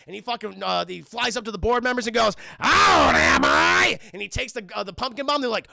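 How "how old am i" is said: loud, slow, menacing Goblin bellow; villain voice. Retold or imitated. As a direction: boom it out slowly like a mad villain. Goblin addresses board members.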